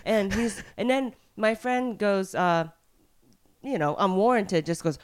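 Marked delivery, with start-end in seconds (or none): none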